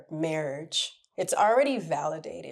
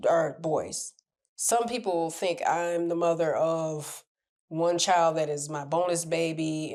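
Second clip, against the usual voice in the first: normal talking voice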